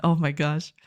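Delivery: ordinary talk, nothing marked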